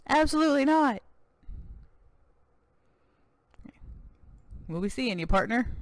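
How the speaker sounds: lightly voiced American cowboy governor, proud; a touch of boastful Texan governor. Governor refuses.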